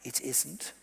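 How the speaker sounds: hushed voice, rising at the end; very quiet. Real, not acted. Speaker quietly lets the audience in on social media's limits, very quiet. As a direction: say it softly, as a quiet aside. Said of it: "Can I say it very quietly?".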